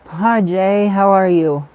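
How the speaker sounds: loud, bright hello to a friend; a viewer arrives and she lights up, loud and glad; excited greeting. Real, not acted. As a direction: call out a happy welcome.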